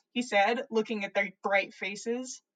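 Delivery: usual speaking voice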